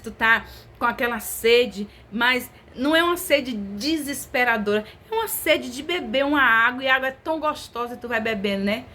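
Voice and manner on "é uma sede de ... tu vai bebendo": ordinary talk, nothing marked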